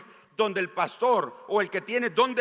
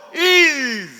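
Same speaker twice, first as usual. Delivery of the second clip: proclaimed command; 8 semitones higher, 8 dB louder than usual. Acted. Pastor proclaims Jesus's command.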